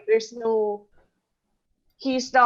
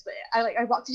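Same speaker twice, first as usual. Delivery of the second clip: usual speaking voice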